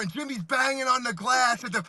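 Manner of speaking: ordinary talk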